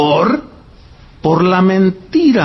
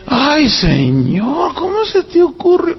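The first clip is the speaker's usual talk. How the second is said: slow, drawn-out sigh rising high in wonder; marvelling praise; 7 semitones higher, slower than usual. Retold or imitated. Preacher recalling praising God on the trip, slow and rising high. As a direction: marvel at the Lord's creativity.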